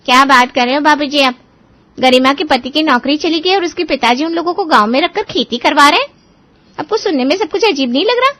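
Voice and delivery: can't believe what she's hearing; shocked daughter-in-law. Acted. Daughter-in-law reacting in disbelief to father-in-law's news, shocked and loud. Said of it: "अपनी बहू को भी विशंबर की बातें बताता है".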